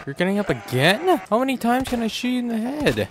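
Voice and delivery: high, loud disbelief; exasperated outburst. Real, not acted. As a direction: yell it, fed up and shocked. Enemy keeps getting up.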